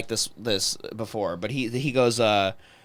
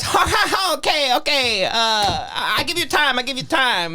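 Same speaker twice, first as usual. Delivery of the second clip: higher nervous laugh in a mock accent; mock Thai accent; 6 semitones higher than usual. Waitress backing off from the table, higher mock accent. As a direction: imitate the flustered waitress, higher. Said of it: "And she goes".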